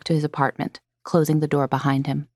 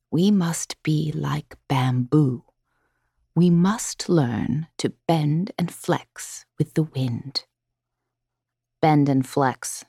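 calm mantra; slower than usual. A narrator's reading. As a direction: savour the words slowly, calmly. Repeating Gran's gentle mantra.

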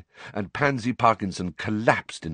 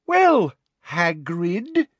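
drawn-out, louder and higher; loud, slow voice; 10 semitones higher, 4 dB louder, much slower than usual. A narrator's reading. Umbridge talking down to Hagrid, loud and slow. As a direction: speak slowly as if to a child. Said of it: "that loud, slow voice".

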